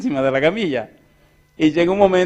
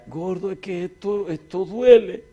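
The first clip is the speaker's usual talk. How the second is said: wife in pain; 5 semitones higher, slower than usual. Retold or imitated. Wife in labour complaining.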